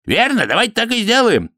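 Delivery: high-pitched priest chiming in; eager agreement. A narrator's reading. Priest agrees to smash the golem, eagerly.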